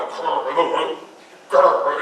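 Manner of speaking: low imitated growling grr; low mock rumble; stomach growl. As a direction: mimic a hungry stomach rumbling. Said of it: "I heard my stomach going".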